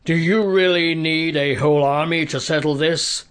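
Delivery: taunting challenge to a whole army; Goliath jeering. A narrator's reading. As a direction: jeer at the enemy ranks. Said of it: "Goliath jeered".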